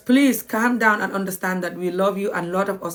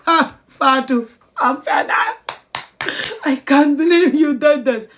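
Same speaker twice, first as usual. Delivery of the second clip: louder, higher exasperated scolding; fed-up mom; 6 semitones higher, 4 dB louder than usual. Retold or imitated. Mother scolds daughter Fatu, exasperated and loud. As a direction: let the frustration burst out. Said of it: "how I will be like".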